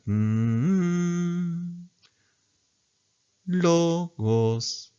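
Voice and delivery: hummed note, then "logos" sung up a fifth; acute pitch accent. Shown as a demonstration. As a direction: sing "logos" with the first o lifted a fifth. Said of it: "Como si ustedes dijeran en música".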